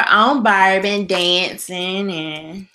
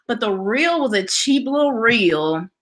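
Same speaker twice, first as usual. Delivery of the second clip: usual speaking voice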